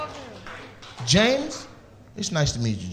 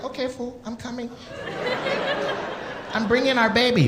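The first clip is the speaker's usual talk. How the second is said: imitated girlfriend; 7 semitones higher, 7 dB quieter than usual. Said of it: "She was like".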